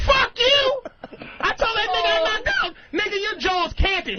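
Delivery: mock angry outburst. Passenger snapping back at being told to calm down, mock furious yell. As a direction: explode back in hammed-up outrage.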